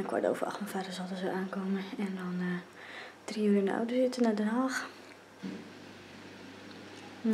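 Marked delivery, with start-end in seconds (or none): 3.2-4.9 whispering. Real, not acted. Still whispering in the early morning.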